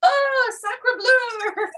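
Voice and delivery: high, slow mock-horrified gasp of a phrase; horrified exclamation. Gallery owner mock-horrified at peanut butter whiskey, high and drawn out. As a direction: clutch your pearls in mock outrage. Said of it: "And he's like".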